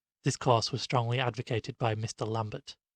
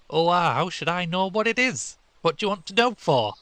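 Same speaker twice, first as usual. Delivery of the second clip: put-on higher, louder boy brushing him off; dismissive schoolboy; 7 semitones higher, 7 dB louder than usual. A narrator's reading. A scout waving off Stanley's question, dismissive and loud. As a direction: shrug it off like a bored boy.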